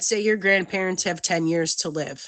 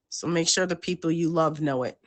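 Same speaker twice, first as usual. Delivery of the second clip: ordinary talk, nothing marked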